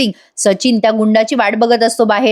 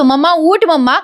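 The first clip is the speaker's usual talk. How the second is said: higher-pitched urgent calling; acted alarm; 5 semitones higher than usual. Ashwin calls his fainted mother to get up, acted alarm. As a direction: imitate a son trying to rouse his mother.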